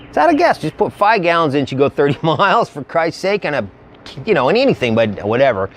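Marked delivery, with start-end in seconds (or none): 0.1-0.6 incredulous repeat. Real, not acted. Repeated in disbelief.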